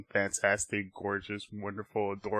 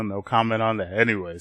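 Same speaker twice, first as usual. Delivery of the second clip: ordinary talk, nothing marked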